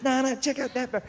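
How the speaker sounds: high, hushed Aussie insistence; squeaky-high Australian undertone. Acted. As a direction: urge it softly and high in an Australian accent. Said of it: "he goes".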